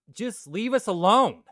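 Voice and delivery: high, loud mock-exasperated plea; mock outburst. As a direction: burst out in hammed-up protest. Mock outburst after faltering plea.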